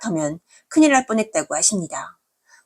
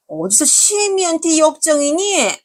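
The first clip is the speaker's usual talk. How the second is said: indignant scolding; 9 semitones higher than usual. Acted. Mother-in-law scolds daughter-in-law.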